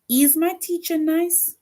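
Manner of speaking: high rising question on "nice"; question tune. Shown as a demonstration. Teacher reading a question aloud to the class, rising tune. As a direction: voice climbs up to "nice?" like a real question. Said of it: "it ends with a question mark".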